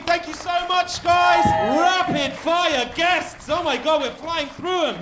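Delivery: high, loud hype to the crowd; hyped host. Real, not acted. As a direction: pump up the audience. Host hyping live audience.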